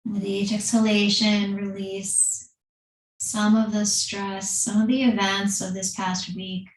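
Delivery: calm, unhurried yoga teacher voice with long pauses; guided meditation. Acted. Leads seated breathing meditation.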